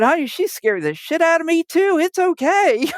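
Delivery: high-pitched reassurance, as the son; imitated son. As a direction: mimic him high, laughing it off after. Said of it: "and he said".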